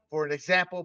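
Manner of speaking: ordinary talk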